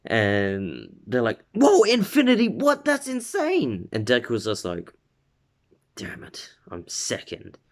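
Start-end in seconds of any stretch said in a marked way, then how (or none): 1.5-3.9 very high, excited squeal of disbelief; hammed-up crowd amazement; astounded classmates. 6.0-7.6 soft whispered curse; muttered disappointment. Retold or imitated. Acting out Deku's private disappointment.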